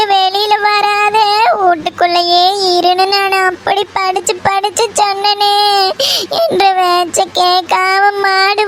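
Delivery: sustained keening with trailing sobs; crying lament. Acted. Mother recounting the fall in the rain, crying lament. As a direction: tell it all in one long wail.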